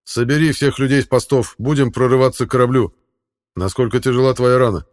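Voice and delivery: loud, brisk orders; commanding. Acted. King giving orders.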